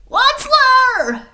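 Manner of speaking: high, loud, croaky shout of the name; loud croaked call; croaking cry. Acted. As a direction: cry out hoarsely in anger. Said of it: "he cried with a crufulous croak".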